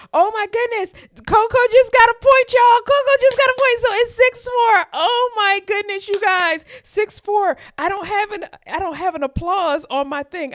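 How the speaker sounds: shrieking high and loud in real delight; screaming with joy. Real, not acted. Live streamer screaming to viewers as Coco wins, ecstatic shriek. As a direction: let it all out as she wins.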